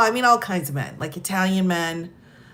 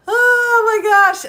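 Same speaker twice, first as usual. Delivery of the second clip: laughing; 13 semitones higher, 8 dB louder, slower than usual. Real, not acted. Laughing hard at own story.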